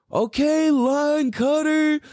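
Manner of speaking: high, drawn-out taunt; mock call, stretching it out slowly; retold heckling yell. Driver taunting the lady going off, retold heckle. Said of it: "what I yelled at her".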